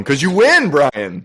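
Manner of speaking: loud, high winner call; excited announcement. Real, not acted.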